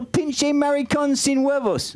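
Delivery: high, drawn-out Spanish from a tourist; clueless tourist's slow, high ordering voice; eager tourist. Acted. Naive tourist repeats the order.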